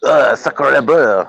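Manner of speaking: slow, hammy French "sacre bleu"; drawn-out mock French exclamation; bad French accent. Joking on a call, mocking the French in a bad French accent. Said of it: "get the accent completely wrong".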